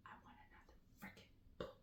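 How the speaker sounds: breathless whisper to the author; hushed, begging aside to an absent writer; whispered plea. Real, not acted. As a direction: whisper it like a secret demand.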